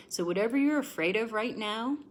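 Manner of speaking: ordinary delivery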